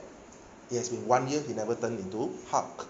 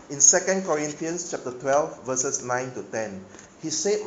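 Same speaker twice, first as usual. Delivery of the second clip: full-force preaching; 4 semitones higher, 7 dB louder than usual. Acted. Preacher drives Paul's verses home to congregation, loud preaching.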